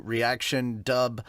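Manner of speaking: normal talking voice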